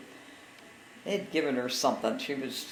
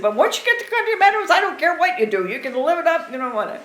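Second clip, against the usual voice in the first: laughing quote; 12 semitones higher, 9 dB louder, faster than usual. Retold or imitated. Medic's quip, told laughing.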